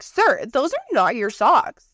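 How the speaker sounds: slow, high mock-stern telling-off; stern wife, sarcastic; mock stern scolding. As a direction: drag it out like a fed-up wife catching him. Scolding husband over socks.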